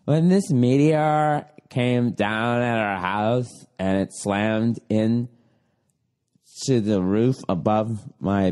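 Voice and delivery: very low, slow, drawn-out mock testimony; dopey slow retelling, sinking at the end; mocking dramatic storyteller. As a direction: stretch each phrase in mock-solemn dread. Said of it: "And it's always just like".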